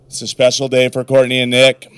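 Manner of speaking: usual speaking voice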